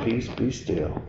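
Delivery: Jesus speaking peace over the sea, softly; calm command. Acted. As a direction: hush the storm gently. Jesus calming the storm.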